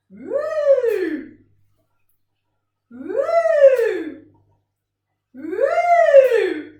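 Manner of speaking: loud, high whoop that rises then falls; swooping woo. Shown as a demonstration. Teacher following a tossed beanbag, loud swooping woo. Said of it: "my voice goes up when the beanbag goes up".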